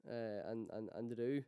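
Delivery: normal talking voice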